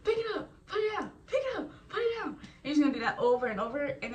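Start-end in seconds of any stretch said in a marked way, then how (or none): 0.0-2.3 high, fast mock instructor orders; imitating a drill instructor, much higher and fast; drill instructor command. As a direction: rattle off commands like an impatient drill sergeant. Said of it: "They're gonna be like".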